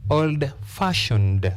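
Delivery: put-on "Old fashioned" slowly, weight on "fashioned"; slow down and hit "fashioned"; stress on FASHIONED, slow. Shown as a demonstration. Teacher to English class, slowly showing compound adjective stress. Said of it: "stress the second part".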